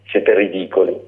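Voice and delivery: ordinary delivery, nothing marked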